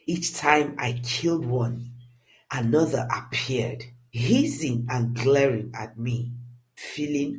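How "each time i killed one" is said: usual speaking voice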